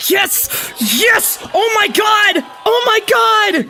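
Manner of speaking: high, loud joyful yelling; ecstatic shouting. Real, not acted. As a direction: scream with pure joy. Hears dream scene will happen.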